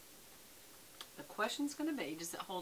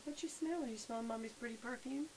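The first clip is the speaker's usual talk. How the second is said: higher sing-song pet talk; 4 semitones higher than usual. Real, not acted. Baby talk to dog.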